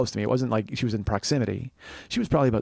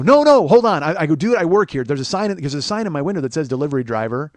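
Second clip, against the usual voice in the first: urgent high plea, easing as it explains; acted-out pleading objection; retold frantic protest; 4 semitones higher, 7 dB louder than usual. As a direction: protest in a rush, trying to stop the ticket. Arguing against a parking ticket.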